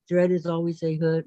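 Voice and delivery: normal talking voice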